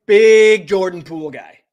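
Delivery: big Jordan Poole guy shouted loud and drawn out; loud slow hype shout; hype call-out. Pretend. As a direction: belt it like an arena announcer. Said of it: "You wanna say it to the mic?".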